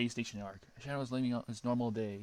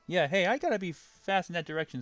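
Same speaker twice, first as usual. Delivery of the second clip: higher, louder cocky hero voice; Sonic voice; 7 semitones higher, 8 dB louder than usual. Acted. Sonic trying to run from Shadow. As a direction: slip away with a nervous grin. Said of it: "said Sonic".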